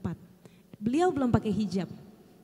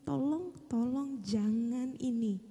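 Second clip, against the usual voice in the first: begging prayer; 4 semitones higher, 5 dB quieter than usual. Retold or imitated. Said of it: "Saya lebih meringis lagi".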